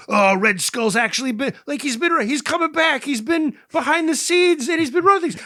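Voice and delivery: high, loud, worked-up hero voice; mock excited discovery. Acting out Captain America learning of Red Skull, mock excited. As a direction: build from indignant discovery to hammed-up resolve.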